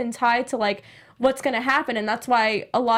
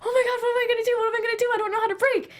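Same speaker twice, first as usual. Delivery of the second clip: high-pitched mock panic, kid losing control; retold panic; 11 semitones higher, much faster than usual. Kid on a bike after dad lets go, high mock panic. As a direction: squeal it out like a scared kid on a runaway bike. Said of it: "I'm, like, freaking out".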